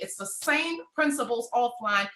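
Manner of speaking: normal talking voice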